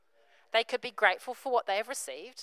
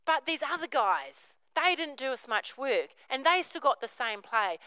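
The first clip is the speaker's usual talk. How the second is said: louder, higher grumbling husband; complaining; 5 semitones higher, 5 dB louder than usual. Retold or imitated. Husband griping to his wife about unfair pay, louder whine. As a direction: gripe about the others' pay. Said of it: "he goes".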